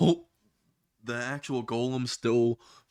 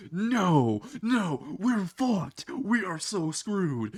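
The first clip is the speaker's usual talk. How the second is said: mock panic; 6 semitones higher, much slower than usual. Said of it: "having a whole fucking panic attack".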